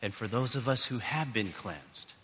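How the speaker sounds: soft, low prayer with long pauses. Real, not acted. Pastor leading the congregation in prayer, soft praying.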